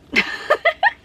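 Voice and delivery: high, bright laughing burst; real burst of laughter. Real, not acted. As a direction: laugh out at your own joke. Laughs at her diamonds joke.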